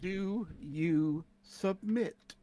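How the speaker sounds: soft, very slow demand; quiet menace. Acted. Captor to a begging captive, quietly menacing. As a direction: draw the question out quietly, as a threat. Player threatens a cornered captive.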